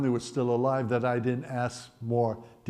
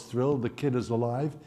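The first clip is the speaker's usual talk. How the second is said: ordinary talk, nothing marked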